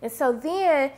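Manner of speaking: usual speaking voice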